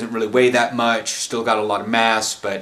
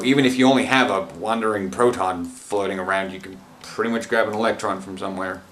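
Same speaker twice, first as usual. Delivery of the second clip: ordinary talk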